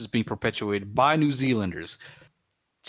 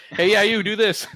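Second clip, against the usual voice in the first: high, loud imitated command; mock bossy call-out; calling out an order; 10 semitones higher, 6 dB louder than usual. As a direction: hail someone and dump the task on them. Retold handing off the job.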